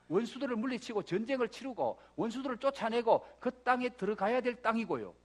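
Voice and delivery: normal talking voice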